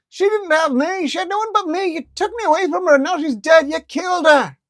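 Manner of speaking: very high, loud furious shout; screaming ferret. Acted. Ferret accusing interviewer of killing owner, furious scream. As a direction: scream the blame at her.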